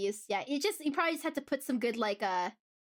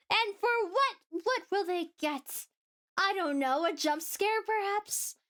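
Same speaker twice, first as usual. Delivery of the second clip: mock excited viewer; 5 semitones higher, slower than usual. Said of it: "The people who are like".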